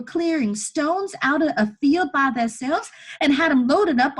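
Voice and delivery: put-on high, loud boy telling it urgently; desperate, excited boy. Boy proving to chained woman he can free her, desperate. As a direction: argue at full volume, voice pitched well up. Elijah argues with story.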